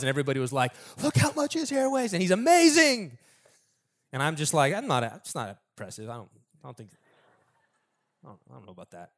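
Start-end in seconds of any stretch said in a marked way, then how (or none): 1.0-3.2 high-pitched mock amazement; over-the-top admiring squeal; gushing crowd. Mimics crowd gushing over Absalom's hair. 4.7-9.1 soft, low, dwindling reluctance; unimpressed muttering. Acted. His unimpressed reaction, sheepish aside.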